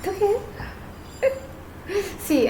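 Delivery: choked up, laughing through near tears; half laugh, half sob, overcome for a moment; tearful laugh. Real, not acted. Tarot reader moved while reading for her viewer, tearful laugh. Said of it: "I just felt so emotional".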